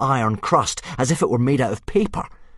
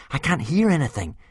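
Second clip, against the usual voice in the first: anxious; 7 semitones higher, faster than usual. Acted. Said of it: "said Hiccup anxiously".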